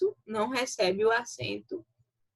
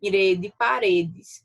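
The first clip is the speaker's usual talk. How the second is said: slow "re de paredes" with the re pushed louder; stressed syllable demo; 3 semitones lower, 4 dB louder, slower than usual. Shown as a demonstration. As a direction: press the re of paredes, slowly. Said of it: "fortes no momento em que são pronunciadas".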